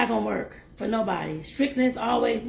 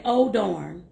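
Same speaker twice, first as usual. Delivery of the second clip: usual speaking voice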